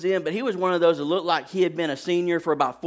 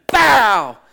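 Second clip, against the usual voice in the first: loud, booming "bow" like a crash; explosive impact noise; 5 semitones higher, 12 dB louder than usual. Retold or imitated. Speaker acts out his high school tackle, explosive crash. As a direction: shout out the hit as a sound effect. Imitated impact while telling story.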